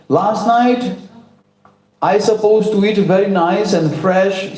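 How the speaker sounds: put-on a cross little boy grumbling; grumpy child. A narrator's reading. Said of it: "He's angry".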